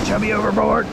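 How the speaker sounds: high alarmed call; alarmed shout. Real, not acted. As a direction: yell out in sudden alarm. Dog falls in water.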